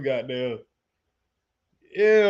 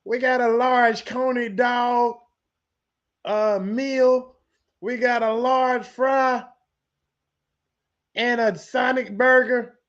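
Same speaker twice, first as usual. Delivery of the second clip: order voice; 5 semitones higher, slower than usual. Acted. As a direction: call the order in slowly, like into a drive-thru speaker. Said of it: "let me do my order voice".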